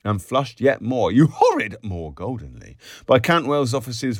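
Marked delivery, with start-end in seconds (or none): 1.1-1.8 high, loud reproach; scandalised protest. A narrator's reading. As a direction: scold in playful shock. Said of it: "Miss Kennedy protested".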